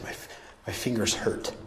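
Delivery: soft whispered, halting start; pained whisper. Retold or imitated. Speaker quotes injured Meg about her fingers, whispered. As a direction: whisper it as if in pain.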